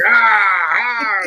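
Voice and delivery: imitated pirate "arr", drawn out; mock pirate. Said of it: "then I became a pirate".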